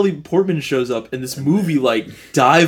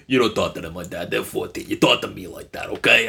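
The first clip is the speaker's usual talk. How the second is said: low, quieter Brooklyn tough-talk; mock Brooklyn accent; 6 semitones lower, 5 dB quieter than usual. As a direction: imitate an actor's put-on Brooklyn accent. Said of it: "Brooklyn accent that you keep".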